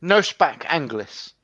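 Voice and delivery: slow, broken English in a fake foreign accent; mock foreign accent. Gamer fobs off a stranger's chat, fake foreign accent. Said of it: "I was in one of those moods".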